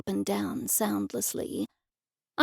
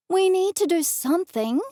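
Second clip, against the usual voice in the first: plaintive; 7 semitones higher, 3 dB louder than usual. A narrator's reading. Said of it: "I said plaintively".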